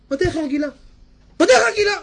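high loud repeat; high indignant repeat; mock outraged cry. Shown as a demonstration. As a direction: shout it high, mocking. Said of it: "repeated escalating".

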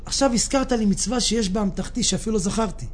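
ordinary talk, nothing marked

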